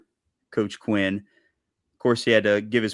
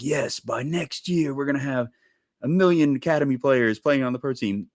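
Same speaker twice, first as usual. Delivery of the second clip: excitable; 5 semitones higher than usual. Retold or imitated. Club people promising big things by next year, excitable. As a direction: imitate over-ambitious excitement, pitched up. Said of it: "just, like, very, um, excitable energy".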